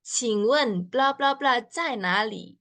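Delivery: slow demo. Shown as a demonstration.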